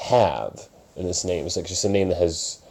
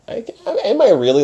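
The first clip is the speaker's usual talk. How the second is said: sudden high, louder surprised catch; 6 dB louder, faster than usual. Real, not acted. Host realizing he is the mentor, suddenly higher. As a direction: catch yourself, surprised at the thought. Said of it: "Am I the Mister Miyagi".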